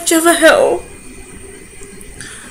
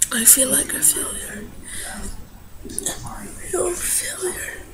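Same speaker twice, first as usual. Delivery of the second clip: softer, whispered through tears; real crying, barely voiced; tearful whisper; 2 semitones lower, 11 dB quieter than usual. Real, not acted. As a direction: whisper it while holding back sobs. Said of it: "I need to stop crying".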